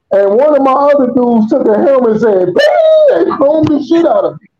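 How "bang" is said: very high, sudden exclamation; imitated impact. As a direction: punch it out high like a helmet blow. Acts out the helmet blow.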